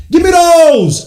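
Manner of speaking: high, loud demand, falling at the end; hyped fan holler; celebratory shout. Real, not acted. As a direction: yell it in triumph. Fan celebrating his team.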